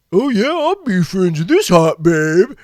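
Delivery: mocking a gullible guy. Mocking lonely men who friend fake girls, dopey eager voice. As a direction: lampoon a knucklehead falling for a fake babe.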